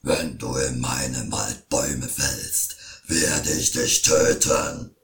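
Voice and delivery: very low, slow, booming troll threat; troll threatens the woodcutter, very low and loud; angry troll. A narrator's reading. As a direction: menace like a huge troll. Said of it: "schrie wütend".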